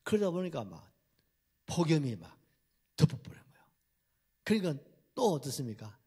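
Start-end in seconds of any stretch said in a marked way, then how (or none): none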